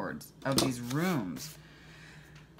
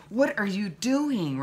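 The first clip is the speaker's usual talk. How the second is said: slow, pointed, higher mock scolding; sharp look-voice; 7 semitones higher, 4 dB louder, slower than usual. Mother silently scolds woman at the movies, sharp and slow. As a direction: demand it like a stern warning glare, drawn out. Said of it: "gave her a real sharp".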